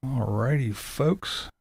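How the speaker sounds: ordinary delivery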